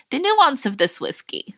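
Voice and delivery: mock connoisseur. Non-drinker mimicking a whiskey taster, mock connoisseur. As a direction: play at savoring the nuance like an expert. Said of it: "palate to be like".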